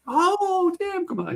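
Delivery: very high delighted exclamation; wife thrilled to see him; Molly delighted. Retold or imitated. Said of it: "she remembered me very well".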